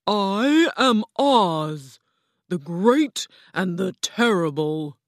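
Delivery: lightly voiced stately enchantress announcing herself; grand Oz. A narrator's reading. Oz as green woman introduces herself, grandly slow.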